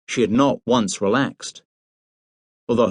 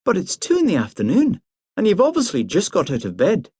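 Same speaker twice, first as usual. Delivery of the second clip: put-on crisp, brisk young woman, pitched up; be briskly no-nonsense; brisk Priscilla; 8 semitones higher than usual. A narrator's reading. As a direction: Priscilla scolding Hamish for lying in bed, brisk. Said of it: "she said briskly".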